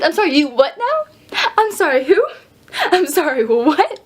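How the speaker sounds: incredulous rising questions; baffled, asking it over and over; acted disbelief. Vlogger replaying their stunned reply to an introduction, incredulous.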